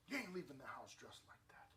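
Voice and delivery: a hushed imitation of a strict father; mock-stern dad, under the breath; whispered stern dad. Preacher whispering the dad's stern refusal.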